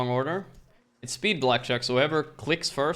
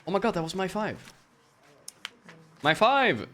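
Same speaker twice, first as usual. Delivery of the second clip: outraged surprise; 8 semitones higher than usual. Real, not acted. Dealer took his card.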